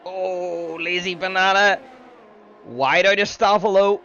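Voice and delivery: excited play-by-play; motorsport commentator. Acted. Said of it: "I will do my best to commentate".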